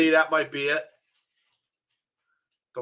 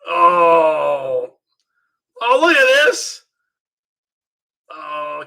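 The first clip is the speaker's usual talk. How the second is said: wrestling commentator; 3 semitones higher, 6 dB louder, much slower than usual. Acted. Calls the wrestling match live.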